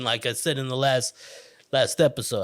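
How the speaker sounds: ordinary delivery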